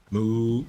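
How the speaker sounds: a short playful moo at a calf; cow moo. Pretend. Voicing a baby cow in game.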